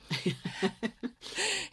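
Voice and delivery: soft laugh. Real, not acted. Laughs about favourite character.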